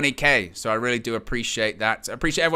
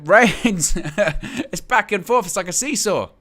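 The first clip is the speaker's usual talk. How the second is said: higher, laughing commentary; amused laughing; 6 semitones higher, 4 dB louder, slower than usual. Real, not acted. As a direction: laugh through the call in amusement. Laughs at the suplex stalemate.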